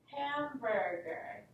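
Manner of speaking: Hamburger slowed, ham-bur-ger, beat by beat; clapped out syllables. Shown as a demonstration. Teacher slowly paces out Hamburger for the class. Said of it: "These words are easily clapped out".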